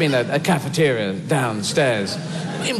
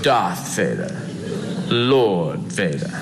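slow, much lower, laughing pompous boom; mock Darth Vader; 6 semitones lower, much slower than usual. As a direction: insist on the title like a vain villain. Vader insists on his title.

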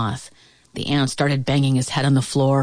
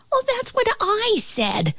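very high flea squeak. A narrator's reading. Flea arguing back at the ant, squeaky voice. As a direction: pipe it up high.

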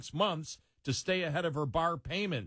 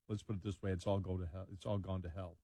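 quiet resigned; 8 semitones lower, 8 dB quieter, much faster than usual. Real, not acted. Dismayed aside on the story.

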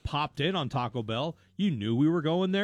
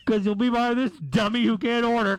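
loud announcer voice; mock ad announcer; 6 semitones higher, 7 dB louder than usual. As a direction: sell the app sarcastically. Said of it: "they're like".

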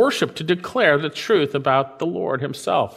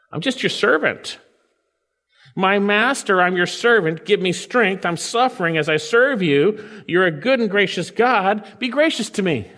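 higher, earnest plea as David; pleading servant voice; 7 semitones higher than usual. Acted. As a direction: beg humbly as a servant. Paraphrased prayer voiced as David.

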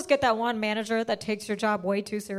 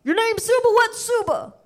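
rude caller; 9 semitones higher, 3 dB louder than usual. Retold or imitated. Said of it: "some guy going".

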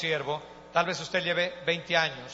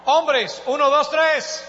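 high, loud count-in; rally the crowd to shout back; arenga al público; 9 semitones higher, 8 dB louder, slower than usual. Real, not acted. Cues groups to shout back. (conteo a gritos para animar al público)

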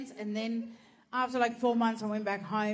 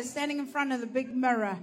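normal talking voice

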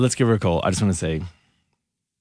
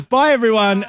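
cheery call out; 9 semitones higher than usual. Retold or imitated. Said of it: "She goes".

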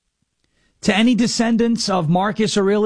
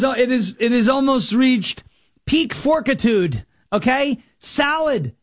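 ordinary talk

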